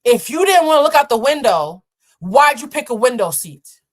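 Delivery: loud, high, combative; seatmate's challenge. Retold or imitated. Seatmate confronting him about the window, loud combative. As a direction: come at him hard. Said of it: "She says".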